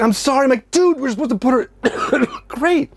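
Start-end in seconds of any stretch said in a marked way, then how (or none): 0.0-0.4 high squeaky apology; very high pitched sheepish little sorry; mock sorry kid voice. Son apologizing after cat escapes. 0.7-2.9 very high exasperated whine; squeaky put-on exasperation; high mock frustrated. Frustrated at son's mistake.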